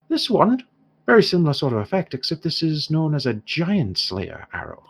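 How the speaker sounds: lightly voiced dwarf, opening high; dwarf shopkeeper. Shopkeeper presents a giant slayer arrow, lightly voiced dwarf. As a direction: show off the goods as an Irish trader.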